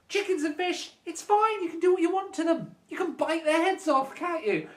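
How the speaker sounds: high-pitched mock generosity; sarcastic permission. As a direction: give exaggerated, absurd permission in a high voice. Sarcastically permitting cruelty to chickens.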